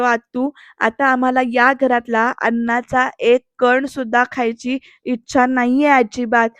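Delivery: usual speaking voice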